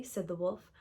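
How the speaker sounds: usual speaking voice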